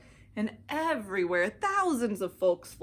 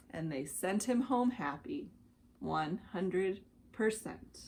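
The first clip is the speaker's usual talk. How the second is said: plain reading voice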